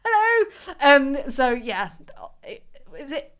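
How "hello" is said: mock bright greeting. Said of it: "before they get here and just".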